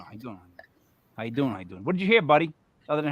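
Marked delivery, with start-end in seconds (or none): 0.0-1.8 low, soft, eerie repeats of how you doing; a hint of a ghostly whisper. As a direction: breathe it like a faint spirit voice. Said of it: "I heard, uh, you know".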